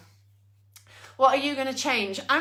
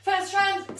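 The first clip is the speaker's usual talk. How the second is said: restarted call, higher and louder; 6 semitones higher, 4 dB louder than usual. Real, not acted. Instructor repeats the round call louder after missing the start. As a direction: call it out again so the class hears. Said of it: "I didn't hear it start".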